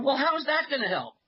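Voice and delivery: hammed-up whining patient; whiny caller. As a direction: toss it back like a sulky patient. Caller's high whine.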